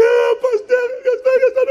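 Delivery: high, loud, tearful wail; wailing woman. Retold or imitated. Pastor voicing the maid clinging to his leg, loud high wail. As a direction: cry out like she's clutching his leg.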